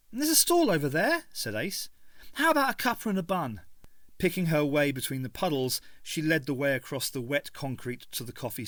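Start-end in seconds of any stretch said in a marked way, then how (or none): 0.1-3.6 put-on much higher, bright eager girl; Ace, cheerful. A narrator's reading. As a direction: pitch the cuppa brightly. Ace voiced.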